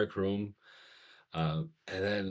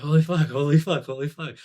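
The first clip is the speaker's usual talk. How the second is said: high, loud, overwhelmed exclaiming; repeated astonished outburst, retold; retold amazement; 8 semitones higher, 10 dB louder than usual. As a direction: relive being blown away, voice lifted. Reliving being overwhelmed by the experience.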